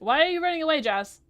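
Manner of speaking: loud, high call after someone leaving. Real, not acted. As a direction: shout after her to stop running. Calling after a fleeing villager.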